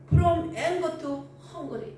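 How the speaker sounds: each word drawn out very slowly; slow emphatic English. Real, not acted. Preacher slowly stressing the English phrase to the church. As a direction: spell out the English phrase for the congregation.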